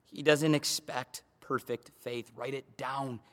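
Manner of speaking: low, soft, reverent close to a point; hushed preaching. Real, not acted. Pastor tells the congregation of a sincere man, hushed low preaching.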